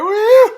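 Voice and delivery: high loud celebratory woo; very high shouted woo; cheering woo. Real, not acted. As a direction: whoop it out like a fan. Cheering Tom Hunkley.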